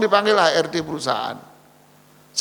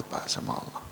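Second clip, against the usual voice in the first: hushed refusal; 14 dB quieter than usual. Acted. Pious man quietly refusing boss.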